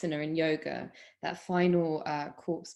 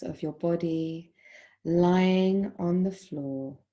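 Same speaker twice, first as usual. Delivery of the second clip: calm, measured meditation voice with long pauses; guided Yoga Nidra. Acted. Yoga teacher guiding students lying in Shavasana, guided meditation. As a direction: guide them unhurriedly into stillness, phrase by phrase.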